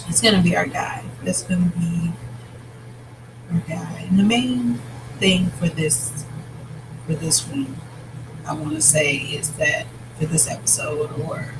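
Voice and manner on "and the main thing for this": ordinary delivery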